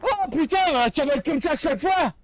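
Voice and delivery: loud panicked voice after a scare; frightened swearing. Real, not acted. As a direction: blurt it out in fright. Another jump scare.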